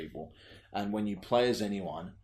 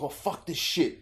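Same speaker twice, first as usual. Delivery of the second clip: lightly voiced Cockney lad swearing; a hint of a Cockney bloke cursing; mock Cockney swear; 8 semitones higher, 3 dB louder than usual. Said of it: "he's like".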